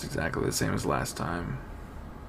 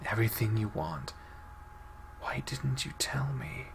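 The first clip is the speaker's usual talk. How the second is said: hushed prayer; 5 dB quieter than usual. Acted. Girl praying to the Clouded Leopard spirit, hushed and earnest. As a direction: pray quietly with all your heart.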